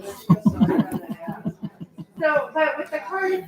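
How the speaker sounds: high airy laugh. Real, not acted. Amused after explaining the silent G, high laugh.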